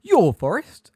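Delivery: loud, high indignant question; frightened indignation. A narrator's reading. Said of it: "shaking now, not only with fright".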